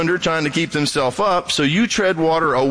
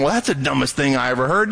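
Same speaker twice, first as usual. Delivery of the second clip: scornful mockery. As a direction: jeer at their belief. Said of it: "you don't sit there going".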